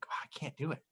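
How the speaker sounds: breathy giving-up whisper; hushed aside. Real, not acted. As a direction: whisper it, embarrassed.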